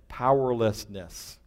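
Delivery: drawn out slowly, every syllable spelled; slow, deliberate dictation. Shown as a demonstration. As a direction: Powerlessness said slowly and clearly, as if for note-taking. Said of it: "Write that down".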